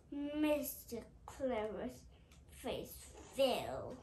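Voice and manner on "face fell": ordinary delivery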